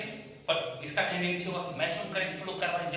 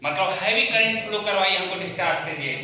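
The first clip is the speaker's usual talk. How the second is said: capacitor's demand; 3 semitones higher, 8 dB louder than usual. Acted. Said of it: "हाँ, तो बोलेगा".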